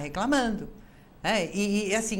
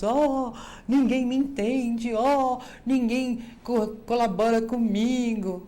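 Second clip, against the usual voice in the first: mock whimper, higher, louder and slow; drawn-out mock lament, pitched up; whiny complaining; 4 semitones higher, 5 dB louder, slower than usual. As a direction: moan it like a self-pitying complainer. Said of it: "ficar reclamando e chorando pelos cantos".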